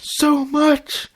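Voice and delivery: high loud cry of pain; wife crying out in labor. A narrator's reading. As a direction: cry out mid-contraction. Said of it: "Twilight cringed".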